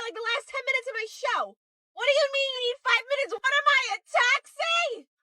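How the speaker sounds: high-pitched, aggrieved whining; high, indignant ranting; exasperated complaint. Acted. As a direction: gripe like a put-upon parent. Parent complains on pickup call.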